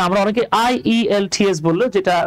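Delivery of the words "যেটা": normal talking voice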